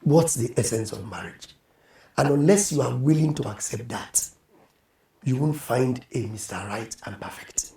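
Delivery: near-whispered sincere counsel; hushed. Real, not acted. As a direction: lean in and say it close and low. Drops into hushed earnest advice.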